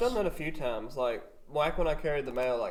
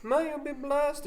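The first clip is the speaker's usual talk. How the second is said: imitating lady; 13 semitones higher, slower than usual. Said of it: "she's like".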